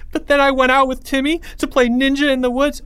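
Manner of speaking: high-pitched frightened account; terrified. A narrator's reading. Terrified Leo telling the third graders, panicky. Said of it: "saw the terror in Leo's face".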